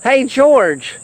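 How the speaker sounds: plain reading voice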